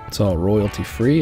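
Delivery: ordinary delivery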